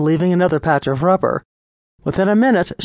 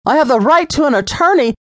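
much higher, louder, fast incredulous shout; outraged mockery; 10 semitones higher, 4 dB louder, faster than usual. A narrator's reading. Lisa scornfully repeating the officer's line, shouted. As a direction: throw his words back at him.

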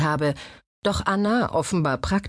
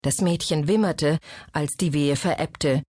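ordinary delivery, nothing marked

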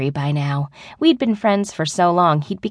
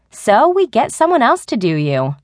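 higher, mischievous suggestion; said with a grin; 5 semitones higher, 4 dB louder than usual. A narrator's reading. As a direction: tease with an audible grin. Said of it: "Becky said, grinning".

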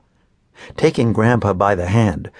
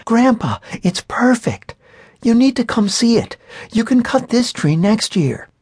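put-on high, coaxing child's voice; pleading little girl; 12 semitones higher than usual. A narrator's reading. Granddaughter coaxing grandpa to see her tree, high and pleading. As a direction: sweet-talk grandpa into coming.

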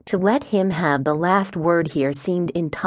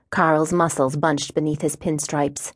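ordinary delivery, nothing marked

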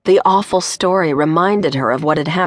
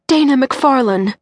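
higher, lightly voiced distraught woman; barely able to speak; 8 semitones higher than usual. Distraught mother naming herself on the phone, barely speaking. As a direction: squeeze out her own name through shock. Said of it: "The woman could barely speak".

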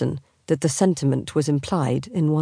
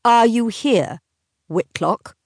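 louder, higher, each word hit; loud demand; 6 semitones higher, 3 dB louder, slower than usual. Acted. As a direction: press the question hard. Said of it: "Catherine said loudly".

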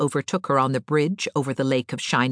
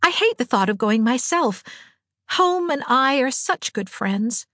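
cheery; 8 semitones higher than usual. A narrator's reading. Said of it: "said Anne cheerily".

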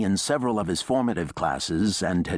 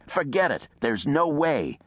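emphatic, raised finality; final refusal; 9 semitones higher than usual. A narrator's reading. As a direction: shut it down for good. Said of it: "unmistakable finality to them".

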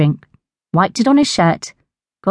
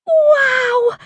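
excited wow. A narrator's reading. Narrator's delighted reaction.

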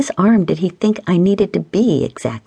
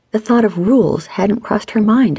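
plain reading voice

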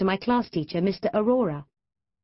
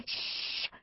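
sharp unvoiced shush; forceful shush; loud shush; 12 dB quieter than usual. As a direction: hiss them silent.

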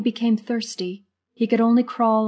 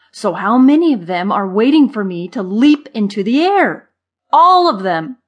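exasperated rant; 2 semitones higher, 10 dB louder than usual. A narrator's reading. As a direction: rant at your friend, hammering each point. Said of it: "bulging with exasperation".

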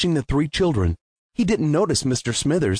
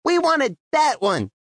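put-on higher, pleading young daughter; little girl; 11 semitones higher than usual. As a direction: correct your dad like a small girl. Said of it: "she added".

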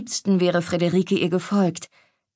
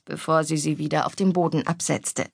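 ordinary delivery, nothing marked

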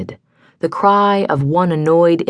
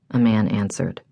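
plain reading voice

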